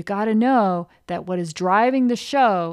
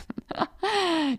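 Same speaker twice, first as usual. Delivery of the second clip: high laugh; 9 semitones higher than usual. Real, not acted. Laughs while coaching.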